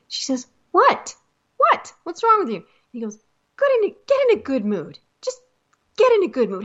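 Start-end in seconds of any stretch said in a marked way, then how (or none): 0.7-2.6 high-pitched, indignant "what"; voiced movie quote, peeved and high; exasperated sister. Acted sister snapping at brother. 3.6-6.6 high, hurried mock exasperation; acted movie quote, pitched up and urgent; exasperated pleading. Acted brother pleading with sister.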